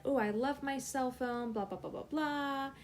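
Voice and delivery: lightly gushing retold brag; mild bragging. Girl showing off her cell phone to friends, mild bragging. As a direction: imitate a little boast, sing-song at the end. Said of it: "I was kinda bragging a little bit".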